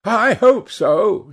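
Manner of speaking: high, drawn-out, amused hostess; lightly amused high hostess voice; amused, laughing tone. A narrator's reading. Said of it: "said his hostess, laughing".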